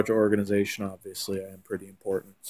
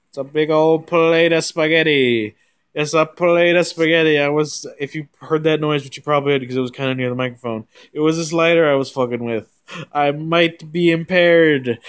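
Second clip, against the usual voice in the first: over-the-top hype voice; 6 semitones higher, 8 dB louder than usual. Acted. Spaghetti bit resumes.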